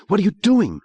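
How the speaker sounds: high alarmed cry; cried out. Acted. Girl grabbed and dragged to the floor, alarmed cry. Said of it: "she cried out".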